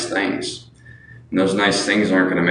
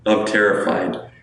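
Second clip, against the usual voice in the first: usual speaking voice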